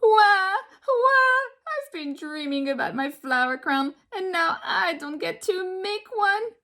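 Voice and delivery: mock wailing, voice strained; crying troll. A narrator's reading. The troll wails about his flower crown, mock sobbing. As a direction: blubber it like a big baby. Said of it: "trolls cried and cried".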